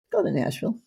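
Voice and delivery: ordinary talk, nothing marked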